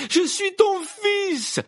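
very high, slow drawn-out cry; anguished son's loud wail; desperate screaming. Acted. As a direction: scream it at your father. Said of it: "criait celui-ci à Croupton".

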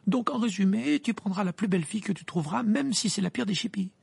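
high, indignant summing-up; irritated. A narrator's reading. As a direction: pile on the points, annoyed. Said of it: "répliqua Hermione avec irritation".

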